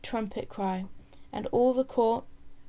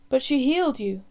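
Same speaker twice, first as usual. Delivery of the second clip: louder, pitched-up cry from the crowd; 3 semitones higher, 7 dB louder than usual. Acted. Bystander in the crowd defending the woman, crying out. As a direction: call out over the crowd's murmur. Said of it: "someone cried out".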